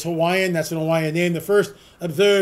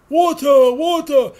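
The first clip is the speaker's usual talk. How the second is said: hammed-up Quasimodo groan, louder and higher; 7 semitones higher, 5 dB louder, slower than usual. Host channels his inner Quasimodo, begging hammed-up. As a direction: croak it like the thirsty hunchback. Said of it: "channeling my inner Quasimodo".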